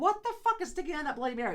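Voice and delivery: high, quick burst of disbelief; incredulous. Retold or imitated. Telling a friend about the Slim Jim in the drink, incredulous. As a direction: blurt it out in baffled amazement.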